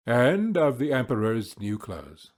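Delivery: ordinary delivery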